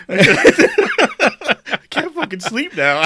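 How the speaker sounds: high, very slow words through laughter; laughing mock regret. Co-host teasing him about guilt, laughing mockery. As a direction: cackle out the fake guilt.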